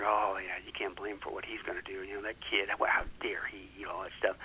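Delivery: low, hushed gossiping whisper; mumbling whisper. Retold or imitated. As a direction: murmur it like a judgmental congregation. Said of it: "you could hear the congregation mumbling".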